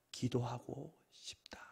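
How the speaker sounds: breathy whispered longing. Acted. Pastor voicing a heart's quiet wish, whispered.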